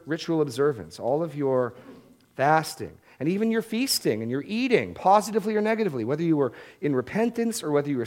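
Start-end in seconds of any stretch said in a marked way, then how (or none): none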